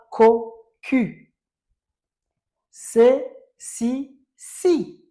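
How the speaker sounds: ko and si called out high and loud; teacher modelling C sounds; loud model sounds. Shown as a demonstration. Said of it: "teacher modelling C sounds".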